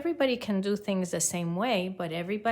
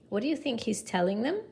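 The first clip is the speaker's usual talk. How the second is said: ordinary delivery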